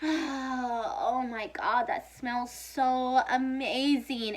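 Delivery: very slow, drawn-out swoon; blissful sniffing. Retold or imitated. Recalling herself sniffing her mom's cleaning bucket, blissful swoon. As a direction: breathe it in and savor every word. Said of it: "I'd just be like".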